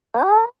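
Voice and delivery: high rising hoot-like cry; imitated owl call. Storyteller imitating the owl by the window, high rising call. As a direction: mimic the owl through a closed window. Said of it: "it was like".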